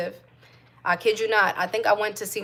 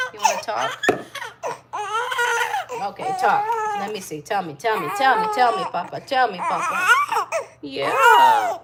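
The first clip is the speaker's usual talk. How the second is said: much higher, sing-song coaxing; 12 semitones higher, 4 dB louder than usual. Real, not acted. Mom chatting with her baby.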